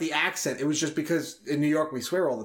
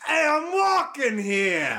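loud, drawn-out New York accent, higher pitched; 9 semitones higher, 3 dB louder, much slower than usual. Shown as a demonstration. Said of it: "in a New York accent".

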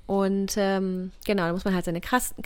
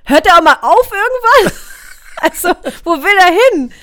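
high, loud alarmed disbelief; 9 semitones higher, 13 dB louder than usual. Retold or imitated. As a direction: cry out in disbelief at the doctor. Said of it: "Ich dachte so".

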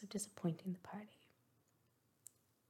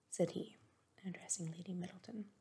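usual speaking voice

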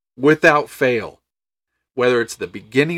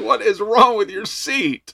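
high, slow, baffled question; mock disbelief at a stranger; incredulous; 9 semitones higher, slower than usual. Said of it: "It's like".

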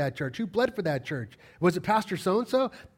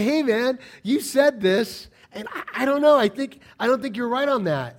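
raised, higher voice challenging a colleague; louder, high-pitched imitated objection; acted confrontation; 8 semitones higher, 7 dB louder than usual. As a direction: act out calling a fellow pastor out. Acts out confronting another pastor.

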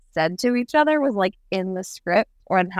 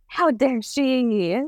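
slow, higher mock-gasped outrage; 6 semitones higher, much slower than usual. Fan reacting to her betrayal, slow hammed-up gasp of outrage. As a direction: gasp then drag it out scandalised. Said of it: "and you're like".

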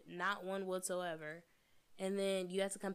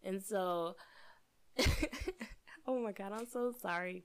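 tipsy, giggling between words; 6 dB louder than usual. Real, not acted. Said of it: "I'm a little drunk".

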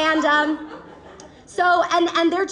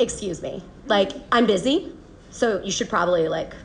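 low, dismissive send-off, dropping softer at the end; cool brush-off; 7 semitones lower, 3 dB quieter than usual. Acted. Acted cool brush-off to the man.

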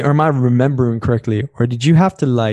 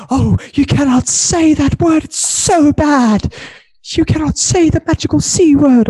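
shocked prude; 13 semitones higher than usual. Retold or imitated. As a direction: gasp it out like a prim, offended person. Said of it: "They're like".

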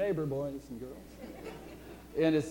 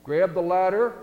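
loud mock rescue yell; 3 semitones higher, 8 dB louder than usual. Helicopter crew yells down to man on roof, loud call. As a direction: call out loudly to someone below. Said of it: "they yell down".

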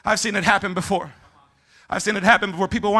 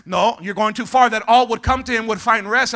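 ordinary talk

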